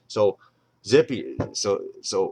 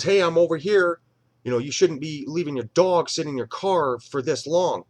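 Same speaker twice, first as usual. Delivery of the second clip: loud, higher scolding call; 7 semitones higher, 5 dB louder than usual. Retold or imitated. Crystal scolding the dog owner, a loud high call. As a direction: call him out across the lot. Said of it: "And she goes".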